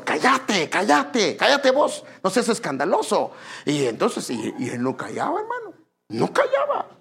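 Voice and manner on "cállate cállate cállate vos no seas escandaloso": acted scolding, pitched up; imitated irritated telling-off; annoyed crowd. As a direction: hush someone with rising insistence.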